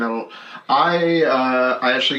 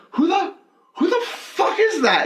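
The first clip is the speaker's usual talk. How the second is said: high, incredulous mock demand; drawn-out, high-pitched disbelief, half laughing; imitated indignant comic. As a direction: act out a thrown performer challenging a heckler. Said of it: "he's like".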